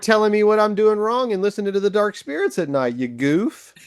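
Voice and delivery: high, fast, comic indignant rant; Job. Retold or imitated. Job tells off the friend correcting him, in a mock indignant rant. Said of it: "And he's like".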